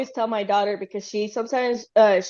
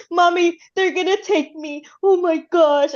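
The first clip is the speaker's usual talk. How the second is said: put-on higher, louder wailing kid voice; mock crying daughter; 7 semitones higher, 5 dB louder than usual. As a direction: imitate the daughter panicking over the cop. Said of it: "bawling her eyes out".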